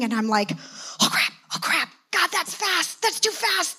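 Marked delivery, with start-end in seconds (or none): 1.0-3.0 freaking out. Retold or imitated. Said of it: "I'm freaking out". 3.0-3.8 panicked. Retold or imitated. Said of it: "I'm freaking out".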